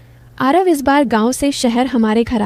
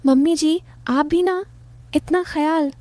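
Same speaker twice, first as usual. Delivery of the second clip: higher, softly voiced son-in-law; coy son-in-law; 5 semitones higher, 3 dB quieter than usual. Son-in-law to mother-in-law over tea, lightly coy.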